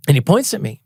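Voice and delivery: normal talking voice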